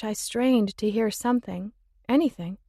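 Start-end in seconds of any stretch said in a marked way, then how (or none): none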